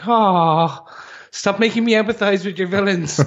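mock-pained, pitched high, whining at the show; playful high protest; exasperated plea. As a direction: complain to the writers in a high mock whine. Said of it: "I was like".

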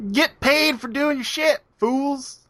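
mock preachy sign-off. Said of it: "playful sign-off".

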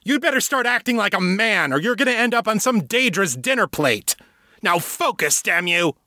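high-pitched, furious scolding; enraged father lecturing his son; angry berating. A narrator's reading. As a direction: berate the boy, voice pushed way up.

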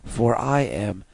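slow, low robotic monotone. Retold or imitated. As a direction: intone it like a machine. Robot Data voice.

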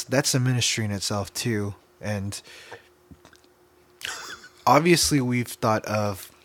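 ordinary talk, nothing marked

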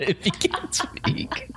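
breathy giggling repeat; laughing. Real, not acted. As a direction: laugh the words out. Mocking repeat of cohost's line.

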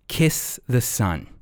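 normal talking voice

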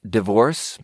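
normal talking voice

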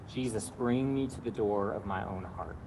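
quiet, slow whole prayer; slow breath prayer. Shown as a demonstration. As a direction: say it slowly and softly. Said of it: "the breath prayer is simply this".

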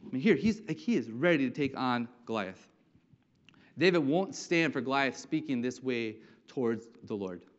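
ordinary talk

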